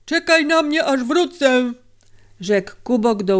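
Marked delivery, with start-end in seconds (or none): 0.1-1.7 raised, higher-pitched character voice; high character call; calling out to a companion. A narrator's reading. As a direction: call it out as the miner, pitched well up. Miner calls to his horse.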